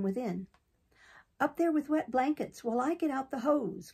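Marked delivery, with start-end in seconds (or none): 1.4-3.9 higher, urgent shouted order; urgent cry. A narrator's reading. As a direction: call the orders up to the roof fast. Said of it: "cried Mister Brown".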